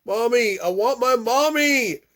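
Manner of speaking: high, loud, drawn-out scream; screaming for Mommy. Acted. Boy screaming for his mother as he is grabbed. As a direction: wail for her as she's pulled away. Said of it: "I scream".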